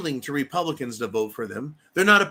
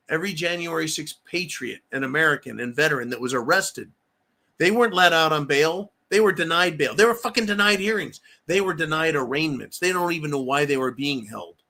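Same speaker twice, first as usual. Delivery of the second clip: ordinary talk, nothing marked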